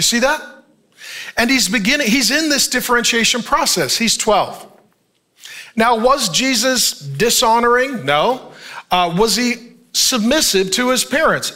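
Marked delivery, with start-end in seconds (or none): none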